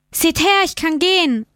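high-pitched call to the crowd; freudiger Ausruf. A narrator's reading. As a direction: call out the good news, voice lifted high. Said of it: "rief er den Leuten zu". (hoch und freudig rufen)

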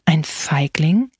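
slow, low whisper with a questioning rise; eerie voice. A narrator's reading. Great-uncle echoing the boy's excuse, eerie whisper. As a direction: whisper it back slowly, menacingly. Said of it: "antwortete der Alte mit unheimlicher Stimme".

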